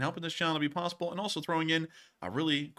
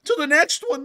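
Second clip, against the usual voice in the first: high, cracking loud voice; 14 semitones higher, 11 dB louder than usual. Real, not acted. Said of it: "that was a voice crack".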